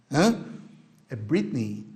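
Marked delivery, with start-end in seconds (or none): 1.1-1.8 Britney with a final "ee"; long I ending. Shown as a demonstration. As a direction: say Britney as BRIT-nee. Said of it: "a pronúncia desse E Y é um I longo".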